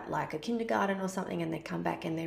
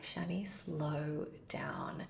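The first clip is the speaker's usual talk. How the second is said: self-reminder whisper; 3 semitones lower, 9 dB quieter, much slower than usual. Retold or imitated. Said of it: "a reflection back to me of".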